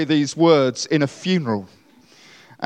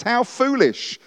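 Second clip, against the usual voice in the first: scornful Paul; 7 semitones higher than usual. Retold or imitated. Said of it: "he says".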